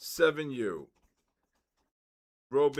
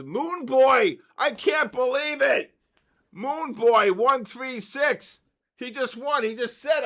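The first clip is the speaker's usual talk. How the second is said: excited; 7 semitones higher, 5 dB louder than usual. Real, not acted. Winner's name drawn on stream.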